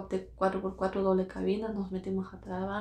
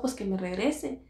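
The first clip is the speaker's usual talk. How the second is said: normal talking voice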